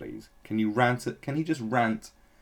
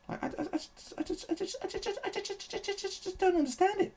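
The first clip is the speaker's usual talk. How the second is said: very high, stammering squeaky voice; hammed-up squeaky stutter; mock flustered stammer; 4 dB quieter than usual. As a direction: stammer like a helpless cartoon nerd.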